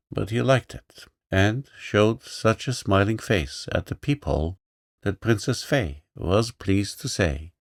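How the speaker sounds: usual speaking voice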